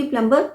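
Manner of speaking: प्लम्बर with the B sounded; wrong pronunciation. Shown as a demonstration. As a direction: say प्लम्बर as "plum-ber". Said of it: "गलत pronunciation है".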